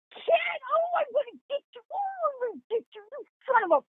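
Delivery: high playful yell at an unseen prankster; mock yell. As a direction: shout after a laughing ghost, half joking. Yelling back at prankster ghost.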